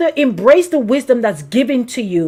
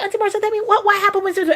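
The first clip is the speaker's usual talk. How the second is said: eager niece; 7 semitones higher, 4 dB quieter, much faster than usual. Retold or imitated. Acting out niece begging for a story.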